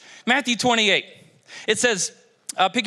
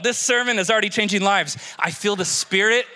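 ordinary delivery

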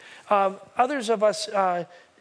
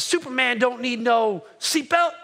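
slow, louder, cocky boxer's boast; Muhammad Ali; 3 semitones higher, 5 dB louder than usual. Retold or imitated. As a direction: boast it like an arrogant champion, drawn out. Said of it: "'cause that's how he talks".

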